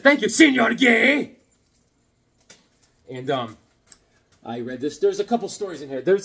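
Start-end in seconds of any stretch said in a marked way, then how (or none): 0.0-1.3 slow, drawn-out mock thanks, higher and louder; hammed-up smart-aleck thank you; cheeky reply. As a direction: needle the teacher with fake politeness. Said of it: "I said".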